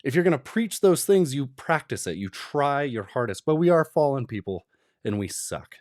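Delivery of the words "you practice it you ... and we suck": normal talking voice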